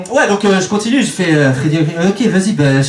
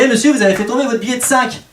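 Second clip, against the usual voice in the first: polite call-out; 9 semitones higher than usual. Retold or imitated. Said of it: "je fais".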